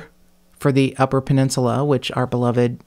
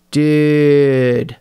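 "dude" with the vowel drawn out long, Californian surfer style, louder; Californian stretched dude; 6 dB louder than usual. Shown as a demonstration. Mocking the Californian accent caricature, a long drawn-out "dude". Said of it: "Stretching out your vowels".

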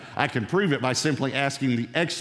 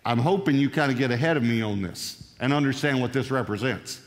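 usual speaking voice

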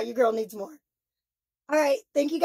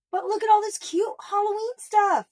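gushing; 8 semitones higher than usual. Real, not acted. Gushing over Halloween haul.

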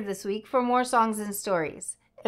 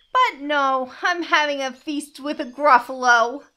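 lightly voiced higher, louder mouse; a touch of proud refusal; boasting mouse; 5 semitones higher, 6 dB louder than usual. A narrator's reading. Mouse turning down snake's feast, mildly boastful.

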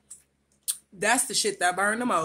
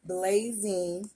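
ordinary talk

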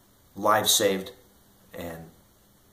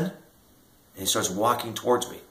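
ordinary delivery

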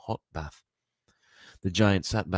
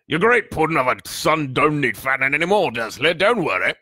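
loud, raised giant's voice; darkly amused giant; 9 semitones higher, 10 dB louder than usual. A narrator's reading. The giant jeers at a father about his son, darkly amused. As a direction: boom out a scornful jibe. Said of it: "The giant chuckled darkly".

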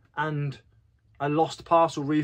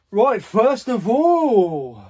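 hyped announcer; 8 semitones higher, 8 dB louder, much slower than usual. Real, not acted. Announcing the sales.

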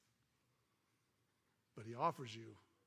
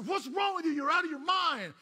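high, loud furious yell; shouted outrage at a stranger; angry shout; 11 semitones higher, 9 dB louder than usual. Acted. Preacher yells at maniac.

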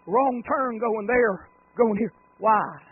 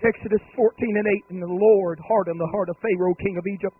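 louder, higher, building to a shouted cry; scripture reading building to a shout; 7 semitones higher, 13 dB louder than usual. Real, not acted. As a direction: read it like a preacher climbing to the peak. Reading scripture with rising force.

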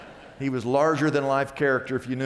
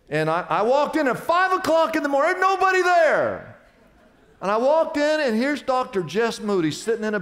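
excited storytelling; 10 semitones higher, 4 dB louder than usual. Real, not acted. Climax of his breakfast story.

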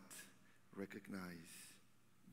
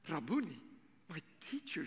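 mild cry; 3 semitones higher than usual. Acted. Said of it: "she cried".

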